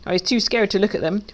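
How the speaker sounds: usual speaking voice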